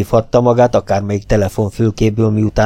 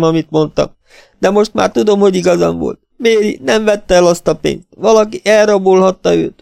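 put-on higher, agitated young woman close to tears; upset tearful sister; 10 semitones higher, 5 dB louder than usual. A narrator's reading. Lila accusing the men of ignoring her warnings, higher and distraught. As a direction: let it pour out in distress.

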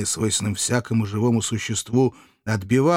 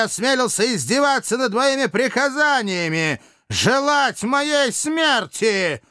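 high-pitched enraged tyrant shouting, words drawn out; furious roar; 12 semitones higher, slower than usual. A narrator's reading. Dictator orders the insolent man brought before him, furious. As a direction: bellow the order in a rage. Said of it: "яростным голосом".

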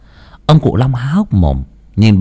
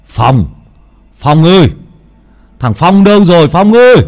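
louder and higher, shouting for Phong; calling out loud; 7 semitones higher, 5 dB louder than usual. Acted. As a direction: call across the yard to the son. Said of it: "mà hô to". (gọi to gọi Phong ngoài cổng)

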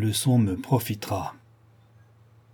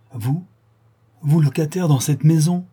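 stupéfait; 5 semitones higher, 5 dB louder than usual. A narrator's reading. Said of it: "stupéfait".

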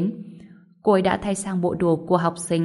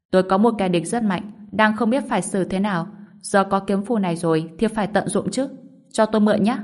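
plain reading voice

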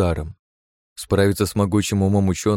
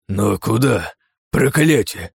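put-on alarmed old man swears, pitched up; a frightened professor cursing; cursing old scientist; 3 semitones higher, slower than usual. A narrator's reading. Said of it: "снова выругался фон Браун". (испуганно ругается)

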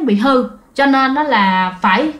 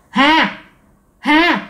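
teasing ghostly call; 2 semitones higher, slower than usual. Retold or imitated. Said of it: "giống như chọc ghẹo vậy đó".

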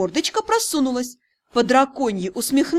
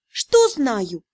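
usual speaking voice

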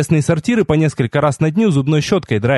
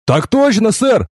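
high-pitched dutiful military reply; hasty bark; 9 semitones higher, slower than usual. A narrator's reading. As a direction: bark it out like an eager recruit. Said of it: "спешно гаркнул я". (поспешно гаркнуть по-военному)

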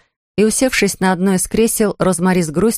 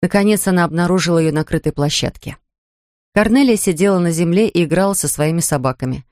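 ordinary delivery

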